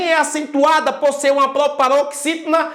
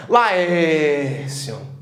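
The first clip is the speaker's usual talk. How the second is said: a lower, slow, weary "Laércio" with the É drawn out; tired call; 10 semitones lower than usual. Shown as a demonstration. Teacher acting tired at night, weary low call. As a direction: sound worn out at night as you call him. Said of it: "Tá cansado à noite?".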